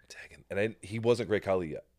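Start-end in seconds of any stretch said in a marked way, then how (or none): 0.1-0.4 whispered aside. Retold or imitated. Whispering a tag request in ring.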